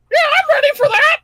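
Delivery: very high, fast little bird voice; squeaky Jub Jub Bird voice. Acted. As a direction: chirp it out like a tiny bird. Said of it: "says the Jub Jub Bird".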